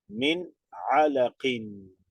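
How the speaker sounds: "Min alaqin" read slowly with no nasal sound on the nun; slow tajweed reading of "alaqin"; Arabic recitation demo. Shown as a demonstration. Teacher modelling the word for the class, slow and unnasalized. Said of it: "Entonces no nasaliza".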